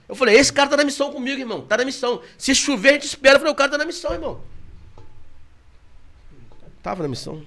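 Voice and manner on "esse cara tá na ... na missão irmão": raised, louder retold boast about the friend; proudly repeating what he told his friend; excited retelling. Telling listeners how he praised his friend, excitedly.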